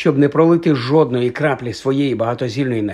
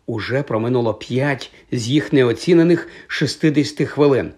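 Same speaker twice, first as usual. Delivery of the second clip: usual speaking voice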